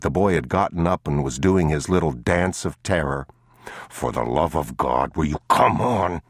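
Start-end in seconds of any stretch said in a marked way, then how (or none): 3.9-6.2 hissed. A narrator's reading. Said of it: "he hissed".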